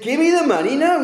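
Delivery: very high, heated mock demand; acted angry demand. Servant choking his debtor for money, angry demand. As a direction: play a creditor losing patience, pitch soaring.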